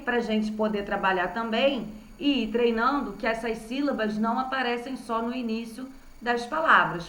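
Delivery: ordinary talk, nothing marked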